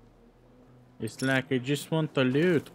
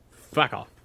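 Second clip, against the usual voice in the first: stern, louder fuck off; 4 dB louder than usual. Retold or imitated. Player telling enemies off sternly, louder. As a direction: put your foot down firmly. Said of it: "give them a stern-voiced".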